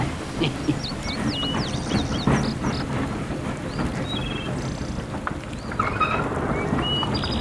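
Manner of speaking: wheezy cackle. Acted. Old-timer laughs at own joke.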